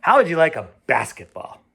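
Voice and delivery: hearty father. Acted. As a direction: offer it brightly to steer him away. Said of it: "his father said".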